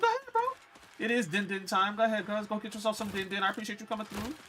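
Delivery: telling a viewer to go enjoy dinner, high and cheerful; high cheery send-off. Real, not acted. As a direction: pitch jumps way up, bright and friendly. Thanking a chatter going to eat.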